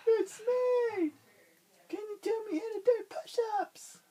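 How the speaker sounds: very high, squeaky little voice; very high eager squeaky starfish. Acted. Starfish at the lobster's door.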